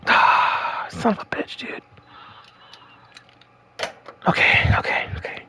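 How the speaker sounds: breathy, voiceless muttering; tense whisper. Real, not acted. Gamer steadying himself after the tense choice, tense whisper. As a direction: whisper it to yourself with long exhales.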